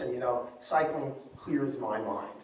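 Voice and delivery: ordinary talk, nothing marked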